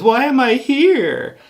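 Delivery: very high, loud, slow bear wail; teddy bear voice. Retold or imitated. A teddy bear laments its life to Dean, in a high, loud wail. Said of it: "the bear says".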